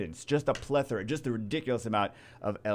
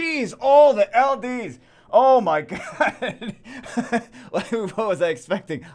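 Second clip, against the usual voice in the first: high, loud, drawn-out disbelief; stunned shout with a laugh; shocked exclamation; 12 semitones higher, 10 dB louder, slower than usual. Real, not acted. Shocked at stacked account.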